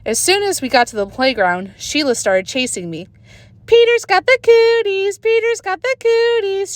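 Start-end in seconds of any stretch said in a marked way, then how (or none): 3.7-6.8 high, loud sing-song chant; girl's taunting yell. A narrator's reading. Sheila chases Peter, high mocking sing-song chant. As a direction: chant it to tease the boy. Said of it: "she yelled".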